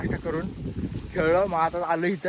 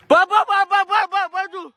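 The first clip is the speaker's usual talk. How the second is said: very high, loud stuttering squeal; squealing with last-ball excitement; excited stammer; 7 dB louder, much slower than usual. Real, not acted. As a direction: stutter in high-pitched suspense. Tense last ball of match.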